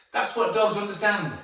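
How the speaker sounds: ordinary talk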